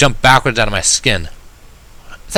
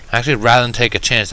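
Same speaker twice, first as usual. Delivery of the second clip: ordinary delivery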